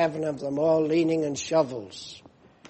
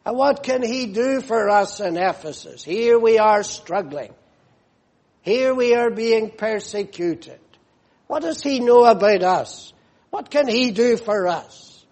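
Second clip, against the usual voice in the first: rising, higher and more anxious as the complaint builds; doubting church members; 5 semitones higher, 7 dB louder than usual. Retold or imitated. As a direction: voice the struggling church's worried doubts. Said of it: "they don't say".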